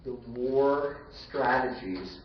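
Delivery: usual speaking voice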